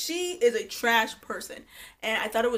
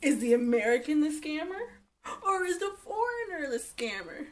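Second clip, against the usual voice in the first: mock game-show host intro, pitched up; hammed-up contestant call; game-show announcer; 5 semitones higher than usual. Game-show style episode intro.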